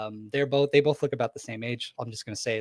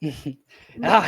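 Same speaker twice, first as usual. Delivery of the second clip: usual speaking voice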